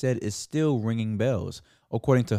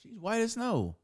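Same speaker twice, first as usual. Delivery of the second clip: put-on higher girl voice; 11 semitones higher, 5 dB quieter than usual. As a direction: read it like a girl. Reading comment in voice.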